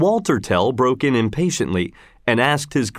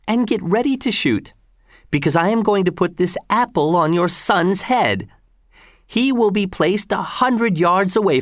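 higher-pitched, lightly voiced taunting governor; governor Gessler; 7 semitones higher than usual. Governor ordering Tell to shoot at the apple, lightly taunting. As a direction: lay out the cruel challenge with relish, pitch lifted.

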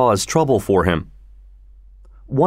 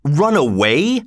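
slow, higher, incredulous question; indignant cry; 4 semitones higher, slower than usual. A narrator's reading. Older brother scorns the idea of fleeing, crying out. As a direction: throw the words back in disbelief. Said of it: "cried the older brother".

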